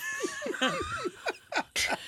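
big, high, helpless burst of laughter; shrieking laugh at the story; loud laugh. Real, not acted. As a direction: let a real howl of laughter go. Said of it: "And I started laughing so hard".

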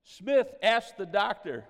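ordinary delivery

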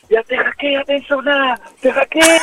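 retold yell. Goalkeeper retelling how he yelled at the corner taker, high mock yell. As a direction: replay the yell to hold the corner kick. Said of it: "אני צועק לו".

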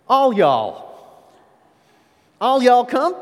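loud, high mock-Texan holler; Texan accent. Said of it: "hear Texans say".

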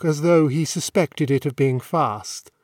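usual speaking voice

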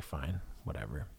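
low, quiet and dismissive, the argument shrugged off; sulky brush-off. Retold or imitated. To his wife after she shuts the door, a quiet sulky brush-off. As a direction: mutter it like you've given up on the fight. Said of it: "and I was like".

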